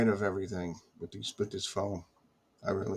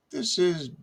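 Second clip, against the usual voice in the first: much higher imitated caller; high put-on phone caller; imitated caller with Pakistani accent; 9 semitones higher than usual. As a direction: lightly mimic a Pakistani-accented caller introducing himself. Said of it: "with a Pakistani accent".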